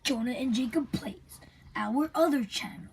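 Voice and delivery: soft whispered plug; whispering. Real, not acted. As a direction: whisper the channel name.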